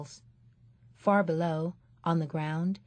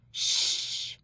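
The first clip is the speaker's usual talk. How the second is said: breathy unvoiced shush. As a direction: hush the noisy crowd. Audience hushing together.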